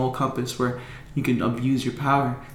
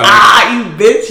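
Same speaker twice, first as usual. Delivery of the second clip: loud, high mock cry of pain; hammed-up outraged yelp; acted pain yell; 7 semitones higher, 11 dB louder, much slower than usual. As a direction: yell out as if your finger is bent back. Reenacts pain as finger is bent.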